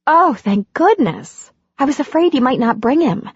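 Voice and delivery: lightly voiced relieved heroine; Andy, relieved. Andy greets the dog.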